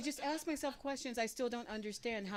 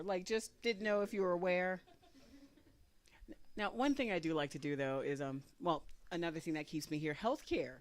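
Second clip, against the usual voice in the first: ordinary talk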